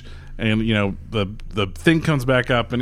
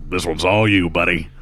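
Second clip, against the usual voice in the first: lightly voiced gruff partner; 7 semitones lower than usual. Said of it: "he's like".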